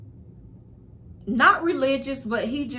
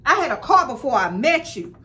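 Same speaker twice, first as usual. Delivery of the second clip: normal talking voice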